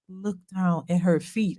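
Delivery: low, hushed aside imitating husband; confiding aside. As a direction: lean in and say it low and soft. Husband leans over to confide.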